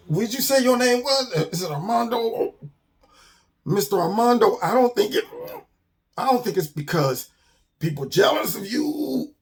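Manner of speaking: high, strained, halting politeness; choking on stench. Acted. Bird to a stinking skunk, struggling to breathe. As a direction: squeeze the words out against a bad smell.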